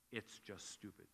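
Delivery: ordinary talk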